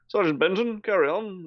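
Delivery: high-pitched military officer impression; Brigadier. Shown as a demonstration. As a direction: give orders as a put-on British officer. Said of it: "It's that kind of voice".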